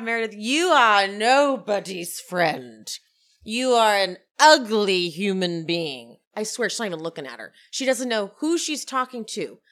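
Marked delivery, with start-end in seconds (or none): 0.0-6.2 slow, emphatic theatrical condemnation; acted haughty insult. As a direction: draw out each insult like a grand diva. Acts out Meredith's insults.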